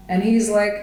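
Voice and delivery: usual speaking voice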